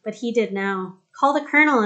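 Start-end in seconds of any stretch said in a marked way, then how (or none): 1.1-1.8 higher, louder call to a soldier far off; shout across field. A narrator's reading. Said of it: "Anaya shouted at the soldier".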